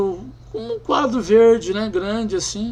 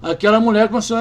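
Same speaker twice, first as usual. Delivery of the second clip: ordinary delivery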